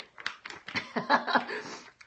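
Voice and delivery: high, breathy giggle; airy laugh. Real, not acted. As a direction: laugh it off lightly. Laughs at her own remark.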